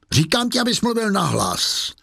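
high-pitched insistent command; exasperated order. A narrator's reading. Inspector losing patience with his prisoner, exasperated order. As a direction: demand it, pitch jumping up.

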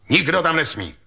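mildly commanding official; official order. A narrator's reading. Commissioner ordering the crowd back.

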